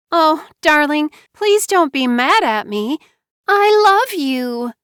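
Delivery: put-on high, loud childish whining plea; little girl's whine. Wife begging husband not to be mad, little-girl whine. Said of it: "she whined in a little girl's voice".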